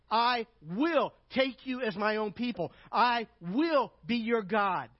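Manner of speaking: slow, loud proclaiming of each promise; emphatic scripture reading. Acted. Preacher reading God's vows to listeners, slow and loud. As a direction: declare each I will like a vow.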